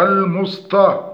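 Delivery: "المسطى" with heavy emphatic t; ta made emphatic. Shown as a demonstration. Teacher demonstrates the error, emphatic t in المسطى. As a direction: thicken the t of "المسطى" into dark "taa". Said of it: "وقلب التاء طاء".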